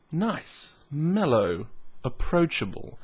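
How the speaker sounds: ordinary delivery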